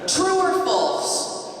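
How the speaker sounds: playful teacher quizzing the audience; quiz host. Pretend. Quiz host posing question.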